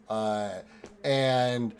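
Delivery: usual speaking voice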